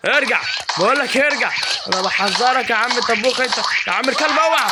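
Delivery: high, loud yelling warning; furious shout. Real, not acted. Gamer screaming warnings at the enemy and dog, furious shout. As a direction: yell at them to back off.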